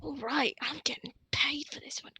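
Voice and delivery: pleased hushed aside; quiet smug informant aside; whisper. Acted. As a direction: whisper it to yourself, satisfied. Informant gloats softly.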